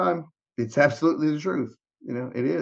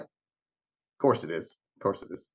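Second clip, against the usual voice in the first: low, soft, defeated aside; wry resignation; 7 semitones lower, 5 dB quieter than usual. Retold or imitated. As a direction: mutter it low, as if giving up. Acting out resigned reaction to coincidence.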